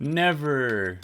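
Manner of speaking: emphatic. Real, not acted. Emphatic joke about never learning.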